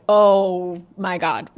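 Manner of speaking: slow, loud horrified gasp of words; mock horror. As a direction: stretch it out in dread. Langdon sees zombie woman.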